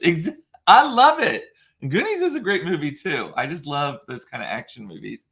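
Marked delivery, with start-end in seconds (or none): 0.0-3.3 high, loud burst of delight; excited. Real, not acted. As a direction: squeal it with delight. Delighted reaction to a comment.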